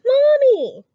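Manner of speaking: high, loud call for mommy; crying out. A narrator's reading. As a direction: shout for mommy across the sky. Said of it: "cried the strange little creature".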